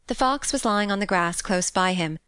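plain reading voice

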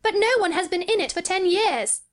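put-on high, incredulous boy voice; high-pitched boyish outcry; crying out. A narrator's reading. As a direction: protest in astonishment. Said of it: "cried Colin".

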